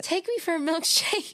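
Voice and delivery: pleading. Retold or imitated. Begging mom to go out.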